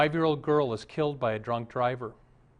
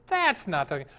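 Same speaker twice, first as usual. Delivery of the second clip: higher, emphatic THAT'S; exasperated objection; 6 semitones higher, 4 dB louder than usual. Acted. Theater manager rejecting the dog's trick a second time, exasperated. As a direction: insist on it, punching THAT'S.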